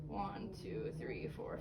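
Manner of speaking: whispered counting. Real, not acted. Counting heart tones under breath.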